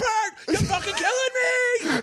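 high strained mock yell; put-on high-pitched outrage; angry friend. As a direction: yell it as an exasperated, hammed-up friend. Imitating angry friend Bobby yelling.

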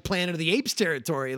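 usual speaking voice

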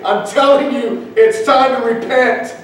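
slow, high-pitched preacher's appeal; urgent warning. Acted. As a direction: press each word on the lost, slowly. Voiced urgent warning to the lost.

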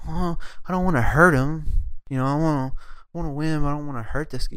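mock wimpy, hushed pleading; mock reluctance. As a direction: imitate someone too meek to fight. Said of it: "if you go in like".

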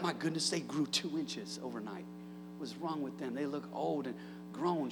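low, soft mock astonishment at how fast they grew; amazed parent. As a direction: gasp it out like a stunned parent. Parent marvels at grown kids.

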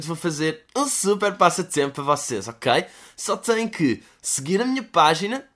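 bright, slow giveaway announcer voice; hammed-up sponsor plug; mock influencer pitch. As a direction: sell the contest like a cheesy influencer.

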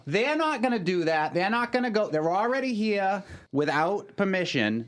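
high, excited mock mother; a parent objecting with a Boston accent; playing the mother. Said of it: "here's my mother now".

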